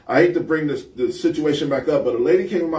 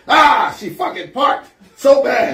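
loud, high, drawn-out complaint; exasperated outburst, slow and pitched up; mock irate customer; 8 semitones higher, 6 dB louder, slower than usual. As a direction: imitate an irate man griping loudly. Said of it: "A guy came behind her and was like".

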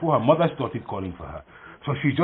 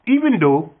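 crocodile deciding; 6 semitones higher, 4 dB louder than usual. Acted. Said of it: "She said".

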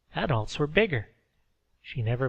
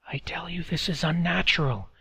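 urgent unvoiced whisper; hushed, fearful man's whisper; 5 semitones higher than usual. A narrator's reading. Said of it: "one man said".

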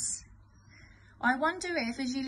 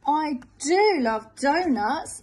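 slow, louder, hearty bear; bear delighted; 3 semitones higher, 9 dB louder, slower than usual. Acted. Bear tells the wolf he loves donuts, slow and hearty. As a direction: savour the word donuts. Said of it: "says the bear".

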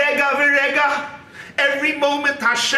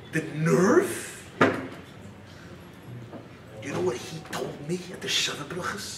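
muttered, much softer griping about insults; bitter grudge-holder; 7 semitones lower, 5 dB quieter than usual. Acted. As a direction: fume quietly over every old slight. Man ranting about old slights.

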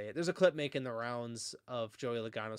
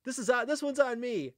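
high, loud shout of admission; mock radio outburst, pitched way up; imitated yell; 9 semitones higher, 6 dB louder than usual. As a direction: yell it out like the driver owning up. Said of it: "he just yells out".

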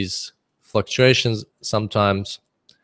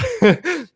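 squeaky high giggle; real laugh. Real, not acted. Laughing at own joke.

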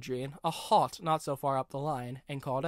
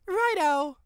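much higher, louder; crisp righto; 14 semitones higher, 6 dB louder than usual. A narrator's reading. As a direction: snap off a salute. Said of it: "saluted Chuck".

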